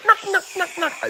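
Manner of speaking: squeaky high knock sounds; high mock knocking. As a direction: tap it out in a tiny high voice. Mimicking knocking puck.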